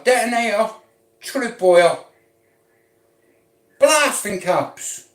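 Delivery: high, loud and worked up, listing bomb parts; heated rant. Acted. Ranting at the listener about bomb parts, high and loud. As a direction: bark each part out, all fired up.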